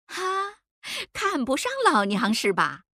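high, teasing challenge from a sassy woman; amused challenge. A narrator's reading. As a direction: open on a high scoffing "ha", then needle him. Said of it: "吴如英笑了". (笑着挑衅地问)

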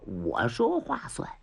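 low whispered promise; secret whisper. Acted. Minister whispering a private promise to the captive.

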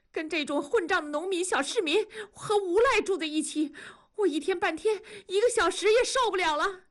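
high, tearful tirade; tearful outburst. Acted. Wife humiliated by husband's borrowing, tearful and angry. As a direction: complain bitterly on the edge of sobbing, pitched high. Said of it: "文丽说着就开始流泪".

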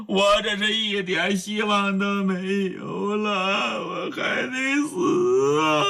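slow, sobbing lament; crying. Acted. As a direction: weep out the hopelessness. Young man breaks into weeping. (哭着慢慢诉说)

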